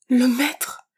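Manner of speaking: plain reading voice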